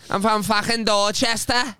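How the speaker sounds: high, drawn-out mock Boston; Boston accent. Shown as a demonstration. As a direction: lean hard and slow into a Boston accent. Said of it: "Do you want me to do the Boston accent?".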